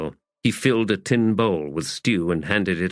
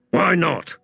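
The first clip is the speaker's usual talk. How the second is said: high-pitched challenge; sharp retort; 10 semitones higher, 3 dB louder than usual. A narrator's reading. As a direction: fire back a challenge. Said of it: "Hedge retorted".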